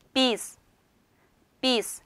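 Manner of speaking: 비스 said carefully as Turkish "biz"; teacher's isolated, clearly articulated 비스; pronunciation model. Shown as a demonstration. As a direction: model 비스 for learners, set apart by pauses. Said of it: "이렇게 읽어요".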